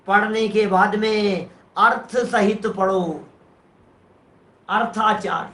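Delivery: ordinary talk, nothing marked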